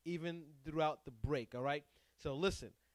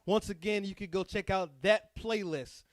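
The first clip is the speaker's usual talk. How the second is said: loud, higher hype-man announcer voice; radio DJ announcer; 5 semitones higher, 11 dB louder than usual. Acted. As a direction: boom it out like a radio host. DJ closes the show hyped.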